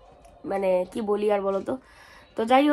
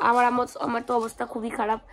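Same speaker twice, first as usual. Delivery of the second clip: hoarse and sick with a cold; real lost voice, rough throat. Real, not acted. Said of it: "গলা ভেঙে গেছে".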